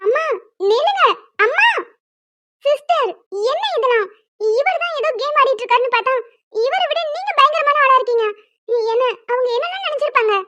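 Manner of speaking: high, worked-up complaint; exasperated scolding. Acted. Woman scolding the sister-in-law for her wild lie. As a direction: tell her off in disbelief.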